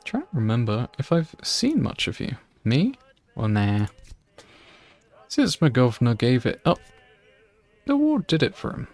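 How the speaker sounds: a hint of a grumpy country gardener shooing her away; gardener's rural accent. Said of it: "Do that accent?".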